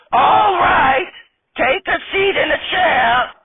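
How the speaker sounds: high, slow, quavering granny voice; old lady voice. Acted. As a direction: play a sassy old grandma stripper.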